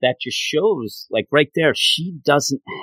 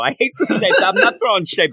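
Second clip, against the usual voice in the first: laughing; 6 semitones higher than usual. Real, not acted. Admitting basketball criticism, laughing.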